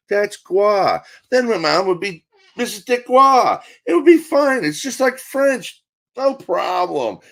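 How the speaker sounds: high-pitched, hammed-up delight, stretching the last words; excited relief. Son reassuring himself about mom's new married name, hammed-up excitement. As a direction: sell it loudly as a giddy, high-voiced solution.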